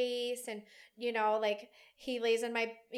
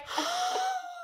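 high intake of breath; acted gasp; 3 dB louder than usual. Mother getting a brief hug, high acted gasp. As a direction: gasp as if savoring it.

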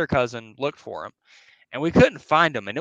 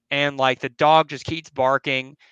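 ordinary delivery

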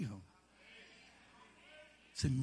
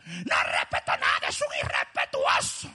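angry retold rant; 5 dB louder, slower than usual. Retelling an angry rant about someone.

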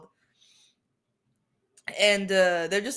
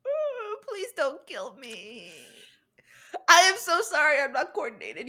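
hammed-up fearful apology; mock panicked apology; 5 semitones higher than usual. Apologizing to the MVP she hit, mock panicky. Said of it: "I'm just like".

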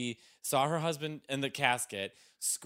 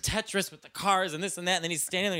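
ordinary talk

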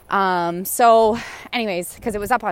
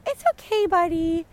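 high, sing-song reassurance; soothing a goose; 6 semitones higher than usual. Real, not acted. Calming a goose that feels threatened, high cooing. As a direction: coo it like talking to a pet.

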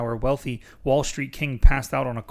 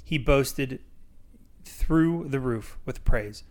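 usual speaking voice

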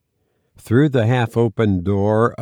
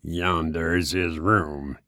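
malicious grin; 5 semitones lower, 6 dB quieter, slower than usual. Acted. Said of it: "with a malicious grin".

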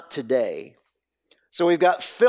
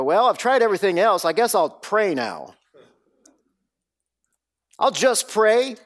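fast, higher, louder mock shrug of a tired churchgoer; mock resigned sigh; 6 semitones higher, 3 dB louder than usual. Preacher mocking the church praying last, hammed-up resignation. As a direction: toss it off like prayer is the last resort.

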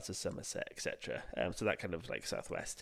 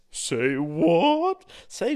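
high, loud, slow put-on voice; hammed-up astonished voice; mock astonishment; 6 semitones higher, 8 dB louder, much slower than usual. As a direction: gasp it out in disbelief.

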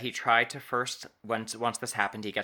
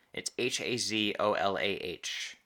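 ordinary talk

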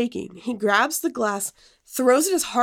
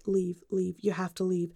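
low, hushed inner urging; talking to herself; 4 semitones lower, 8 dB quieter than usual. Retold or imitated. Woman silently urging herself to get out, hushed self-talk. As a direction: murmur it privately, softly and lower. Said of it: "to myself, I was like".

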